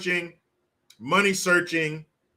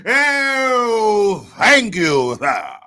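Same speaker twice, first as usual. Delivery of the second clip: mock grovelling gratitude; 3 semitones higher, 7 dB louder, much slower than usual. Driver thanking the leader for gas prices, loud mock grovelling. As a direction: stretch the "Oh" into servile thanks.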